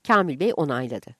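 ordinary delivery

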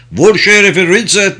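high, loud commanding shout; shouted order. A narrator's reading. Autocrat ordering Ritzet to shoot, shouted. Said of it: "diye bağırdı otokrat".

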